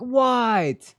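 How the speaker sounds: high, slow, wounded innocence; mock-clueless friend whining; Soma impression. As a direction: ask it like you did nothing wrong. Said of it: "he'll be like".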